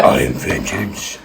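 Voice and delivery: very low, slow, soft rasp; deep, slow vigilante voice; Batman. Acted. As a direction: drop down and drag it out darkly. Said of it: "Batman is like".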